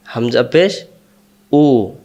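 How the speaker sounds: usual speaking voice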